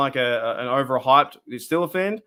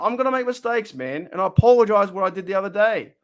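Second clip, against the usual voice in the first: usual speaking voice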